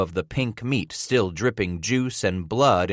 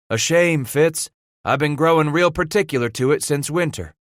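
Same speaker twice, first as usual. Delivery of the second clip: higher, easygoing rueful complaint; mulatto trapper voice; 7 semitones higher, 4 dB louder than usual. Acted. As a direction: mildly mourn the beard. Beckwith complains about shaving.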